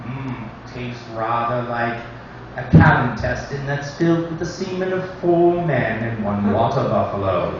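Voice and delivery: a hint of the TV survival host savouring a bug; British accent. Comic imitating the Man versus Wild host, light British accent. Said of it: "British accents".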